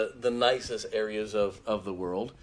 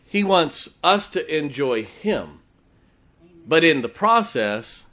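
rising, slow preaching emphasis; 5 semitones higher, 9 dB louder, slower than usual. Acted. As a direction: lift it like a sermon point. Preacher building to a point.

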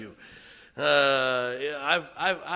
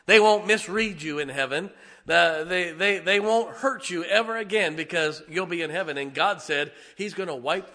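raised, loud preaching voice; emphatic preaching; 5 semitones higher, 8 dB louder than usual. Real, not acted. Preacher assuring congregation nobody will hurt them in heaven, emphatic. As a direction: promise it forcefully to the congregation.

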